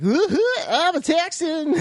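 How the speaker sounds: laughing, high hammed-up Texan yell; silly Texas cowboy whoop through laughter; put-on Texan accent. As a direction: play a cartoon Texan, cracking up. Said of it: "speak like a Texan for five minutes".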